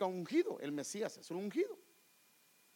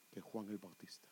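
whisper; 11 semitones lower, 13 dB quieter than usual. Acted. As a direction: quote sinks into a whisper. Preacher quotes Jesus' testimony. (cita de Jesús susurrada)

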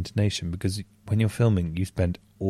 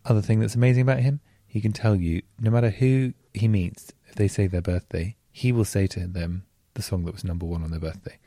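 normal talking voice

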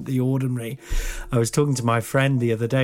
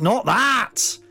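emphatic retort; 9 semitones higher, 3 dB louder than usual. Retold or imitated. His retort to them.

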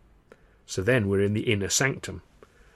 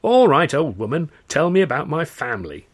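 cocky challenge; 7 semitones higher, 9 dB louder than usual. Acted. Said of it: "So Charlie says".

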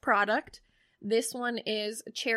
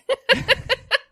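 high laugh; 14 semitones higher, 8 dB louder than usual. Real, not acted. Laughing at own teaching.

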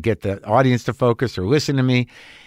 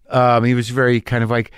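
normal talking voice

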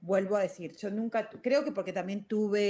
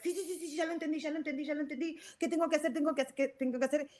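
rushed, higher, brushing it off fast; hurried and impatient; 6 semitones higher, much faster than usual. Retold or imitated. Her past self rushing through life, impatient and hurried. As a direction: rattle it off like someone always in a hurry. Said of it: "siempre estaba apurada".

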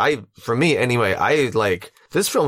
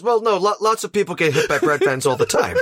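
much higher, laughing comic voice; hammed-up fibber chuckling through; mock reassurance; 11 semitones higher than usual. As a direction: play the liar brushing it off. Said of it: "He says".

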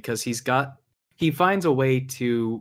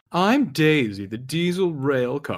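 sassy Daisy voice; 3 semitones higher, slower than usual. Retold or imitated. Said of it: "that kind of sass to it".

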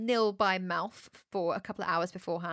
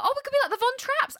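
excited; 14 semitones higher, 4 dB louder, faster than usual. Real, not acted. Excited squeal over nine babies.

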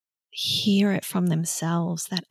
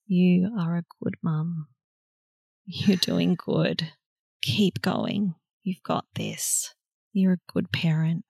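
gentle self-affirmation; 3 dB quieter than usual. Shown as a demonstration. Affirmation said to ourselves.

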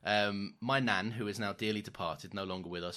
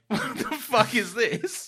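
high, slow, loud baffled dog voice with a laugh; 12 semitones higher, 7 dB louder, slower than usual. Retold or imitated. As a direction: voice a confused park dog. Dogs reacting to K9.

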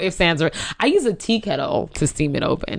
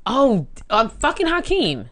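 usual speaking voice

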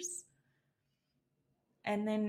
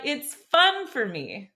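FUN punched loud and higher, drawn out; playful stress on fun; 4 semitones higher, 7 dB louder than usual. Real, not acted. Podcaster pointing up her own pun on her word, playful stress on fun. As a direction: lean on FUN to flag the pun. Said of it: "See what I did there?".

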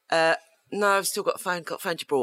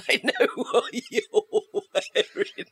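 laughing through the words; giggling, barely getting it out; chuckling; 9 semitones higher, slower than usual. Retold or imitated. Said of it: "Megan just literally started chuckling".

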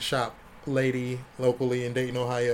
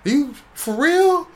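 disbelief building to high, loud, hammed-up hysteria; starstruck freak-out; 13 semitones higher, 9 dB louder, slower than usual. Young artist realizing his idol really called, freaking out. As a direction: lose it more with each exclamation, squealing high. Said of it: "I did that for about a minute, and then I calmed down".

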